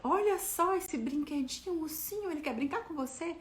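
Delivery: mais aguda e lenta, carinhosa; voz doce pra criança. Retold or imitated. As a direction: distraia a criança com doçura. (mãe atrai a filha com o ursinho, voz doce)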